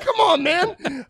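slow, high, loud protest; mock indignant. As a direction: drag out the protest, offended. Arguing with daughter, retold.

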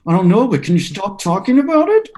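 high, loud anxious plea to stop talking; a dad anxious on a stuck chairlift begging his daughter to stop talking, rising panic; panicky dad. Retold or imitated.

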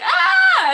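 loud high mock scream; panicked scream. Imitating the brothers at Crowley's appearance, mock panicked scream. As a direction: shriek as if a spider appeared.

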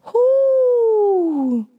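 one long, high hoot; high sustained exclamation; high drawn-out whoo. Retold or imitated. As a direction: hold it high, then let it slide down. Re-enacts her whoo reaction.

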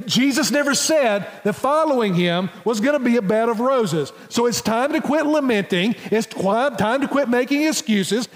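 fervent sermon climax; impassioned preaching. Acted. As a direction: drive the call home with fervor. Preaching at full force.

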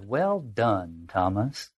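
low, measured praise; mysterious tempter. Acted. Unexpected voice addressing Thomas, low and measured.

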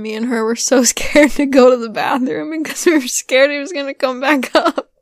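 giggling through the words; laughing. Real, not acted. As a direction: laugh while telling it. Laughing about fearing the toilet.

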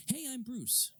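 high, flippant mock Bruce line; mock Batman reveal. To Rachel, mocking Batman's blunt reveal, high and flippant. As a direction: toss off the reveal like a joke. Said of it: "he says it in a way where he's basically saying".

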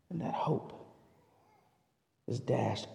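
breathy near-whisper; hushed sorrow; whispered grief. Acted. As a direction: barely voice it as the hope dies. Grief of child loss.

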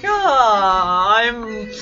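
hammed-up insecure lament, drawn out slowly; mock self-doubt. Podcaster explains fear of joining collaborations, mock self-doubt. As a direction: moan it slowly, playing up the inadequacy. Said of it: "kinda really super intimidated because I'm like".

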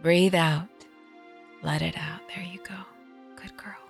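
fading to a soft whisper; soothing. Acted. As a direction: talk her breathing down. Aunt coaching panicked breathing.

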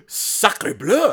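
loud, high mock French exclaim; hammy French chef outburst.

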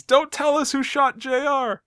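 high, drawn-out mock cry; imitated yell. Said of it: "covers his ears and he yells".

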